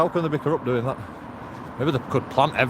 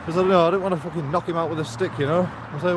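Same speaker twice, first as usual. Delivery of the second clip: normal talking voice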